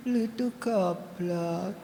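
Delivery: low, soft, slow Semarang-style insult. Retold or imitated. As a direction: say it gently the Semarang way. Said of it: "orang Semarang gini". (logat Semarang yang halus, pelan)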